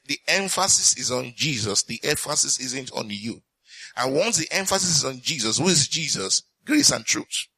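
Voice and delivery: ordinary talk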